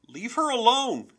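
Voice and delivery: high, loud protective shout; small boy's furious yell. A narrator's reading. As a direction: scream it to defend your sister. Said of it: "yelled Owen".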